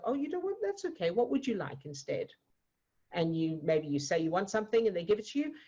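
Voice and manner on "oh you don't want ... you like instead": high, sing-song kind parent; gentle parent. Retold or imitated. Parents soothing a child who pushed away food, in a high kind voice. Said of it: "And your parents go".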